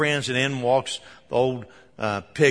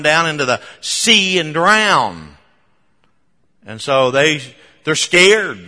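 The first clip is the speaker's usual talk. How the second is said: louder preaching drive; 3 semitones higher, 8 dB louder than usual. Acted. Preacher building his sermon about the hog owners, preaching loud. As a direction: press the point home to the congregation.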